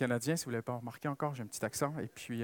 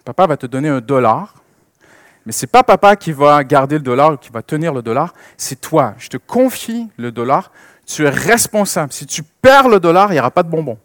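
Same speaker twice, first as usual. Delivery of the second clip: louder, firm fatherly explaining; father to little daughter; 12 dB louder than usual. Retold or imitated. Father explaining responsibility to his little daughter, firm and louder. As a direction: lay out the rule to a four-year-old. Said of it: "je lui dis".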